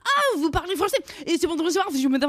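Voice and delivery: high, gushing rapid French; excited French speakers. Retold or imitated. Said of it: "so then people are like".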